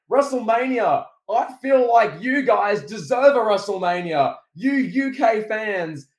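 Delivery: loud, high, hammed-up crowd promo; Cena promo voice. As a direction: shout it to the arena like a superstar. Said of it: "He's like".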